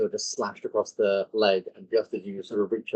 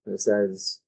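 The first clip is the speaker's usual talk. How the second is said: ordinary delivery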